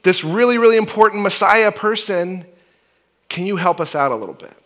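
loud, high calling out to get attention. Retold or imitated. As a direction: hail Jesus from the roadside. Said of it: "they're crying out".

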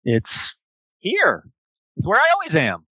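high, sweet toy-animal voice; childlike plush friend. Acted. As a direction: speak like an eager stuffed elephant bear. GM voices Billy Bucks.

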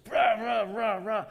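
high, wordless barking tirade; mock angry ranting. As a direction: imitate someone domineering going off. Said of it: "you're the dominant one".

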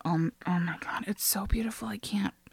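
whispered, out of breath with awe; hushed breathless whisper. Retold or imitated. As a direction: whisper as if the beauty took your breath. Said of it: "couldn't catch my breath".

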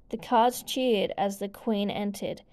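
ordinary delivery, nothing marked

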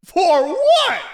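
shouted incredulous for what about leaving the suite; outraged shout. Real, not acted. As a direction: demand it in disbelief. Outraged repeat.

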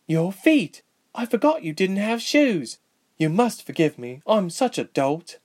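high-pitched, startled gasp of concern; a flustered, high-voiced apology; gasped dismay. A narrator's reading. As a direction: exclaim it, aghast at yourself. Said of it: "bent down and gasped".

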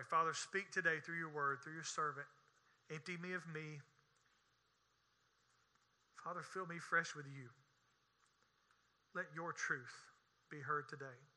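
low, hushed prayer voice. Real, not acted. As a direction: pray softly and low, pausing between petitions. Leads a prayer.

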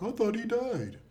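high far-off voice quoting; high put-on quoting voice; mock quote. Quoting a character's line.

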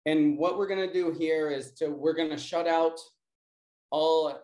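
usual speaking voice

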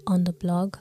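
normal talking voice

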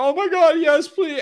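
loud, high hammed-up begging; mock crying plea. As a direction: imitate a desperate, tearful yes. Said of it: "they're crying and they're all emotional".

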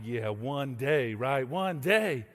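low, slow, dreamy boast; mock swagger; smug strutting. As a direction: savor the fantasy of owning it. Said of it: "strutting around the house like".

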